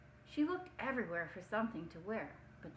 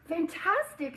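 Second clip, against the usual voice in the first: high, loud, gushing fantastic; lavish the word fantastic; gushing praise; 10 semitones higher, 9 dB louder than usual. Acted. Princess praising the dragon, gushing high and loud.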